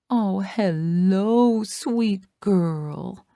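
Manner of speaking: slow, tender coo; gentle baby talk to a newborn. A narrator's reading. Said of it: "I coo at her".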